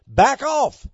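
loud, high order to retreat; acted defiant demand. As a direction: belt it out as an acted warning.

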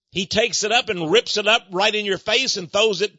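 ordinary talk, nothing marked

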